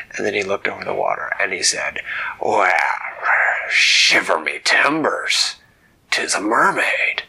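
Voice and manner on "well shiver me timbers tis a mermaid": loud pirate exclamation; amazed pirate captain. Acted. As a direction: gasp at the sight like an old sea dog.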